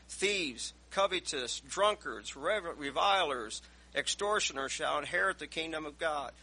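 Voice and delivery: loud, ringing scripture reading; preaching scripture. Acted. Preacher reading First Corinthians aloud to the church, loud and ringing. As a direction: proclaim the verses to the congregation.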